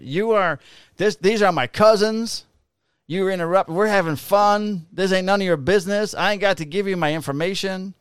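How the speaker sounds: sneering imitation of her; mocking the woman. Cop mimicking the drunk woman scolding him. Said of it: "she's like".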